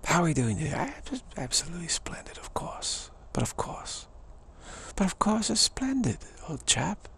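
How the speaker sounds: put-on lilting British accent, trailing off softly; British old chap. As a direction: play a mock-genteel British chap.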